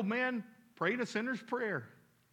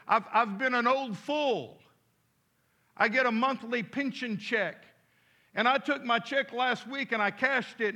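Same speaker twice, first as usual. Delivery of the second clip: lightly voiced old man, higher and louder; 4 semitones higher, 6 dB louder than usual. Old man confesses his binge to the boy, lightly acted. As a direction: play a storytelling old man, raised voice.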